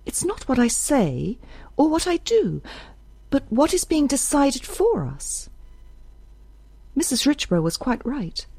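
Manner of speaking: put-on Isbel, insistent young woman. A narrator's reading. Isbel tells Judge they must return, high and insistent. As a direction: play Isbel pitched high, pressing the point.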